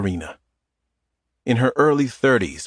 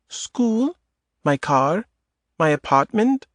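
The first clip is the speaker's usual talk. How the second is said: put-on higher woman's voice with a light Eastern European accent; 7 semitones higher, slower than usual. Adita teasing Tom in the grocery aisle, Eastern European accent. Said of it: "In a light Eastern European accent".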